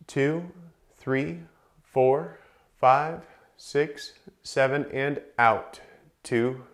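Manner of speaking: calm instructor counting out the breath cycle; counted breathing cues. Acted. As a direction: pace the class through each breath. Counts a guided breathing drill.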